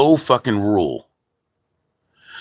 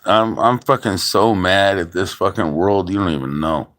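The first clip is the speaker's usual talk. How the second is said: ordinary delivery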